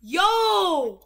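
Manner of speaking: loud sudden yell; shout. Real, not acted. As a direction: call out loud and sharp.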